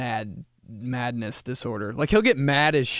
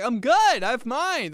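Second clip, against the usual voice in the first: polite refusal; 11 semitones higher, 5 dB louder than usual. Retold or imitated. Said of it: "I'm like".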